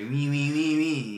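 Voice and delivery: lightly put-on Italian accent; mock Italian. Friends joking about talking like Italians, mock Italian. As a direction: chant it as a pretend Italian. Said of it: "We should be like Italians".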